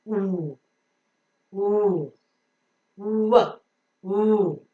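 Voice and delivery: oo made with rounded lips for W; phonic W. Shown as a demonstration. Teacher giving the letter W's sound, rounded oo. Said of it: "W has got a phonic sound".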